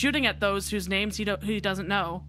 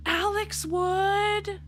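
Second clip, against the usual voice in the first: drawn-out high line; 11 semitones higher, much slower than usual. Acted. Dramatic line then repeated.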